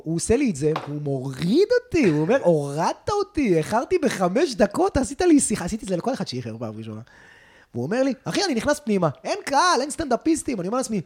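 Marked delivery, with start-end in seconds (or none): 2.4-5.6 slow, rising, louder indignant complaint. Retold or imitated. [name] to the host about being told off, indignant and slow. 8.2-10.6 higher, louder, disbelieving; incredulous retelling. Retold or imitated. [name] describing the empty club, incredulous and higher.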